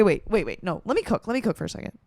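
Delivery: usual speaking voice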